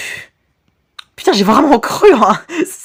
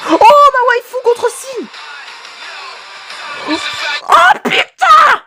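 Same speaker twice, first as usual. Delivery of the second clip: high-pitched thrilled cry; excited anime fan; 12 semitones higher, slower than usual. Real, not acted. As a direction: squeal with delight at the screen. Reaction video, big moment.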